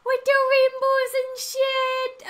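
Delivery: high, loud delighted squeal; real high-pitched fan excitement; excited squeal. Real, not acted. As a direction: squeal at spotting something you love. Squealing reaction to a pride sticker.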